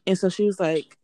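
ordinary delivery

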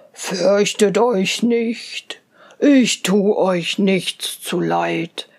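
put-on a big kind bear calming the children; gentle bear. Bear speaks in the tale.

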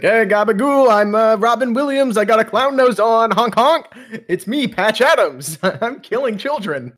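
high, loud goofy clown voice; hammy Robin Williams clown bit; silly clown. Pretend. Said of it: "He's like".

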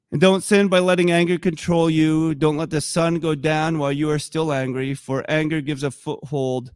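ordinary delivery

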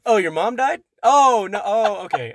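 pretending to discover the mother is alive; mock surprise. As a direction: play the fake gasp of a speaker caught out. Mock Biden backtracking, acted.

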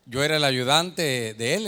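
ordinary talk

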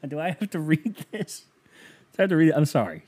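high, pleading protest after a laugh; laughing reluctance. Real, not acted. Balking at reading a listener's painful email, laughing reluctance.

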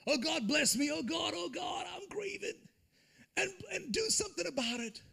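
high, breaking shout of grief; anguished cry to God. Acted. Nehemiah crying out to God, high anguished shout.

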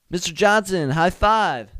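high, loud, eager acted hail; calling out a greeting. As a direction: offer the principal a big high five. Acts greeting the principal.

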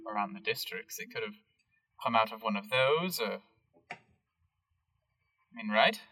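put-on light British accent, hesitant and evasive; British Harold. Harold the greenhouse keeper dodging the group's questions, British accent. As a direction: hedge in a mild British accent. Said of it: "Harold is, all caps, British".

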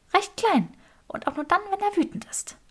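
high, light female voice; Granger's voice. A narrator's reading. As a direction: lift it high and teasing. Granger answers Sabini, teasing.

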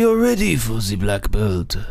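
put-on hammy Japanese accent as a judo master. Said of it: "Two of the worst Japanese accents".

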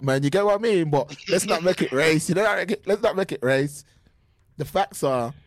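ordinary delivery

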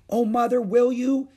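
high, loud eager question; delighted hope. A narrator's reading. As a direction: burst out with sudden hope. Beezus gets hope of new cake.

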